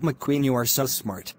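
ordinary talk

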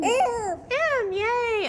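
high excited praise; cheer. Real, not acted. Teacher cheers a child's correct answer. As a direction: cheer him on brightly. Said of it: "cheering child's right answer".

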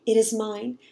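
ordinary delivery, nothing marked

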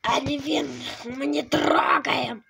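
normal talking voice

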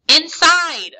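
high, loud barked order; narrated villain's sudden order; chilling command. A narrator's reading. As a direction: command them sharply, pitch and volume jumping. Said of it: "a chilling voice commanded".

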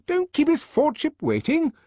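very high, loud scolding; high-pitched, officious command; impatient reprimand. A narrator's reading. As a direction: chivvy the boy before his superior. Director orders the hesitant boy.

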